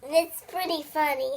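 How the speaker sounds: usual speaking voice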